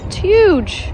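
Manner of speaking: "huge" thrown up high and loud; "It's huge" as a high, loud burst of wonder; amazed exclaim. Real, not acted. Marvelling at mushroom.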